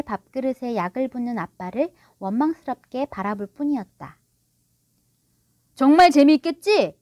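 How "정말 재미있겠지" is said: high, loud, hopeful question; fishing for approval. A narrator's reading. Father seeking agreement for his prank, eagerly. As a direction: push forced enthusiasm on the children. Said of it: "아이들 눈치를 보며 말했다".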